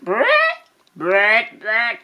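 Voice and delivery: rolled lips brrrt of a pen scratching; playful pen-scratch brrrt; scribbling noise. Acted. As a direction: buzz out the scribble sound. Vulture writing noise.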